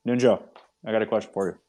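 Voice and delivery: talking through a mouthful; words around a bite of cereal; chewing while talking. Real, not acted. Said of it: "I'm chewing".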